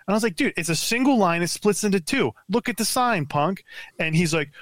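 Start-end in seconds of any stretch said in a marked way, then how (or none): 0.4-3.6 retold scolding.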